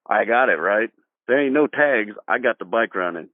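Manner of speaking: usual speaking voice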